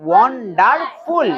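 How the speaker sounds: "ওয়ান্ডারফুল" in three beats; "ওয়ান্ডারফুল" with each syllable held apart. Shown as a demonstration. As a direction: sound out ওয়ান-ডার-ফুল. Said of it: "syllable করে করে পড়ো".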